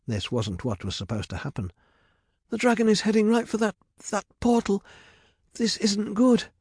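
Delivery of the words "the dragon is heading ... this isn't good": high-pitched panicky warning; frightened. A narrator's reading. Medea heading straight for the portal, frightened. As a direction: stumble over the words in alarm.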